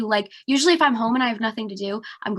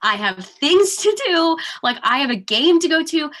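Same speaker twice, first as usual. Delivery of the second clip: raised, louder retold outburst of disbelief; exasperated disbelief; 7 semitones higher, 7 dB louder than usual. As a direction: replay the moment you refused to believe it. Retelling her positive test reaction.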